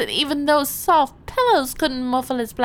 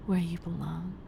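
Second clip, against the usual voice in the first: whispered; 6 semitones lower, 8 dB quieter than usual. Acted. Hypnotic reassurance to the officer.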